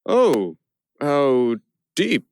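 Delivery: gushing insincerely; faked appreciation. A narrator's reading. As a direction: pretend to be moved by the art. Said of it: "but I needed to fake it".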